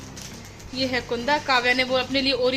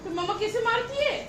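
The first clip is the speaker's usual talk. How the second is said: playful teasing; 9 semitones higher, 5 dB quieter than usual. Real, not acted. Mother asks her toddler how mama hits, high playful.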